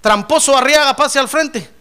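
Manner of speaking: loud, high classroom roll call; mock teacher shouting across a classroom. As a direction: call a pupil up to the front, loudly. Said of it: "Imagínense en la escuela".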